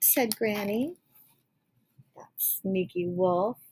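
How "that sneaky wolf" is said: usual speaking voice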